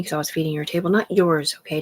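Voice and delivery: ordinary delivery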